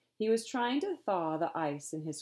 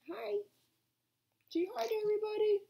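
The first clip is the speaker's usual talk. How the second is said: doggy voice; faster than usual. Real, not acted. Owner talks to her dog in high baby talk. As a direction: coo at the dog like a pet.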